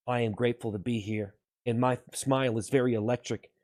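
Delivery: low, soft solemn sincerity; earnest pledge. Pretend. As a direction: welcome them quietly and earnestly. Solemn mock-earnest pledge to viewers.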